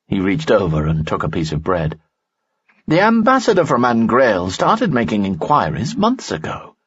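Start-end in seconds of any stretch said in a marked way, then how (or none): 2.9-6.8 Lanson, higher voice. A narrator's reading.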